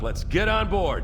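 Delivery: loud, high call over the noise; urgent shout to board the dropship. Acted. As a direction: hurry everyone aboard, shouting. Urging everyone onto the dropship.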